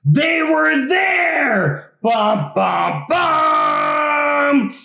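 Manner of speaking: big, high shout with a rising bum bum bum sting; dramatic reveal. A narrator's reading. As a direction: belt out the spooky reveal like a horror-movie chord.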